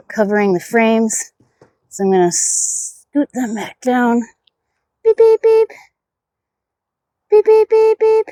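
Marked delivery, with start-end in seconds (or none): 5.0-8.2 high-pitched, loud little beeps; playful beeping. Pretend. Beekeeper being a dork over the hive, playful high beeping. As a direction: chirp out quick, dorky horn beeps. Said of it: "Sorry, I do that. Just, I'm a dork".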